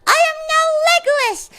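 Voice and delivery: high, loud, hammed-up protest; overacted indignation; mock indignant yell. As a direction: protest like a shameless scammer. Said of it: "And I will say".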